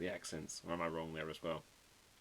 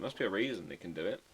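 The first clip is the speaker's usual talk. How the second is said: ordinary delivery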